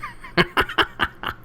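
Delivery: high, breathless laughing fit; cracking up. Real, not acted. Reader floored by the spelling of Jennifer, breathless laughter. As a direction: burst into helpless high giggles.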